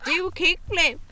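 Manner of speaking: put-on kids' taunt. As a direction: imitate teens heckling a skater. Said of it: "go to someone".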